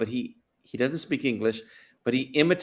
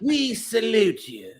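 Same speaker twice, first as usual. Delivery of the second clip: high, stretched mock British salute; English Jesus; 9 semitones higher, slower than usual. Saluting the crowd as a comic English Jesus. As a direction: draw it out grandly in an English accent. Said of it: "English Jesus on the Sermon on the Mount".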